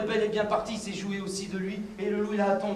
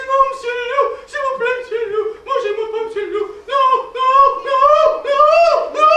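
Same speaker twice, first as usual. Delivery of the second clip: high, loud, near-crying begging; terrified pleading; 14 semitones higher, 14 dB louder than usual. Acted. A sheep begs the hungry wolf not to eat it, panicked. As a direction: plead for your life, voice cracking.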